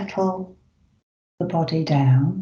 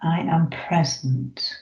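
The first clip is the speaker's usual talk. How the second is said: slow affirmations; slower than usual. Shown as a demonstration. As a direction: let each affirmation land slowly. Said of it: "the first one is".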